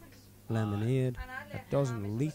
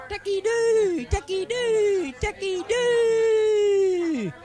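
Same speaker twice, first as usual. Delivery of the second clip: slow, much higher and louder repeated howl; 10 dB louder, much slower than usual. Acted. Driver howling on a dark country road, drawn-out howls. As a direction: howl it like a mad dog at the moon. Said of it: "began to howl".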